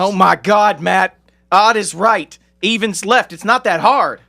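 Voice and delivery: loud, high-pitched coaching rebuke; exasperated. Acted. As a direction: berate a hopeless pupil. Acted coaching quarrel, exasperated.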